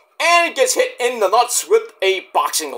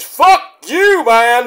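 loud, high defiant yell; mock outrage; 5 semitones higher, 7 dB louder, much slower than usual. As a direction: snap back at critics in mock fury. Mock retort to impression critics.

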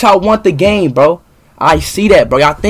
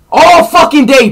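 frustrated outburst; 6 semitones higher, 4 dB louder than usual. Real, not acted. Ranting about chat spam.

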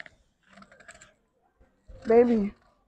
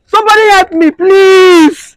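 high, loud desperate scream; screaming for help; 10 semitones higher, 8 dB louder, slower than usual. Acted. Wife calling for help over husband's body, desperate scream. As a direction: scream out for rescue.

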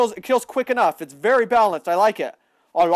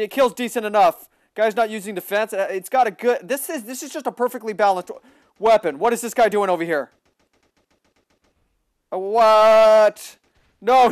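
usual speaking voice

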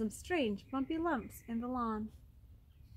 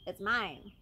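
ordinary delivery, nothing marked

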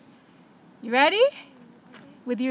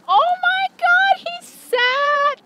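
much higher, louder delighted cry; thrilled shout; 10 dB louder, much slower than usual. Real, not acted. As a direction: shriek with joy that he actually sat. Dog finally obeys.

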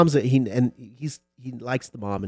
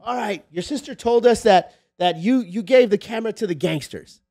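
raised, louder interrogating cop voice; mock cop interrogation; 10 semitones higher, 7 dB louder than usual. Police chief grilling a little boy, raised and loud. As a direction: press the kid hard like a cop.

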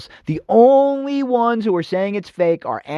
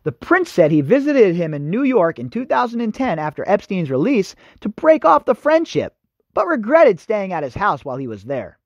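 normal talking voice

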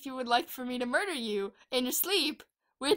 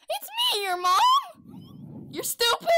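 high squeaky voice; slower than usual. Pretend. Kid voicing mom, shouting.

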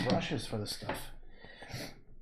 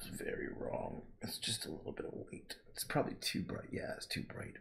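whisper; 5 semitones lower, 9 dB quieter than usual. Real, not acted. Muttering critique of own painting.

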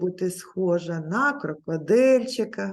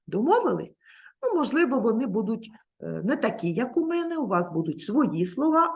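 ordinary talk

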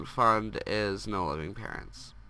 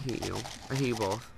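usual speaking voice

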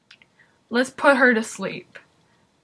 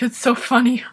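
ordinary delivery

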